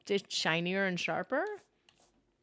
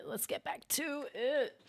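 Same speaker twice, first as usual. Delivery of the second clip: higher, slow silly voice; put-on voice; 7 semitones higher than usual. Shown as a demonstration. Streamer repeating in a silly slow higher voice. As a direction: say it again in a goofy higher voice.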